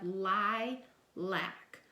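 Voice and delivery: lilac stretched out slowly; slow blending. Shown as a demonstration. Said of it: "put those two syllables together".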